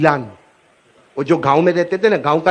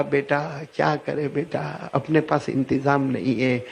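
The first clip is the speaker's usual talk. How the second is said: soft, low tearful mother; crying mother; 4 semitones lower, 8 dB quieter, slower than usual. Acted. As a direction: whisper through tears, slowly. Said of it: "اب ماں بھی روئی". (آہستہ، دھیمی آواز میں روتی ماں)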